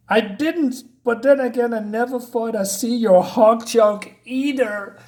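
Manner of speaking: mock reading. Reading a silly comic line mockingly.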